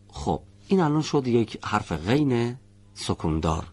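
ordinary talk, nothing marked

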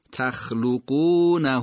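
تخلقونه slow, with the final ha vowel drawn out; long ishba vowel on تخلقونه; lengthened final vowel. Shown as a demonstration. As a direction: stretch the end of تخلقونه. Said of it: "من کشیده کردم و اشباع کردم".

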